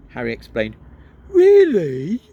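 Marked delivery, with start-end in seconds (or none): 1.3-2.2 very high, louder thrilled question; delighted by the gadget; excitedly. Acted. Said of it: "said Mister Weasley's voice excitedly".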